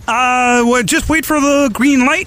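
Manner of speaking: high, slowed frog puppet voice; Kermit the Frog. Pretend. Voicing Kermit for the mock audition, high puppet voice. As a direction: squeeze it up like Kermit. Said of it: "Kermit the Frog".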